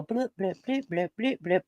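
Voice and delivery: quick electronic blip sounds; imitated blips. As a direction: mimic little buttons blipping. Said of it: "They go".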